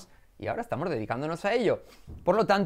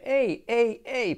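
higher, drawn-out hey calls; calling out; 10 semitones higher, much slower than usual. Acted. Learner calls out to an object, hailing it. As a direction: call to it, "hey" three times. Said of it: "puedes decirle".

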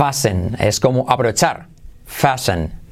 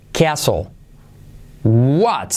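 ordinary delivery

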